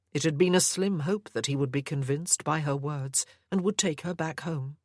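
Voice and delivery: ordinary delivery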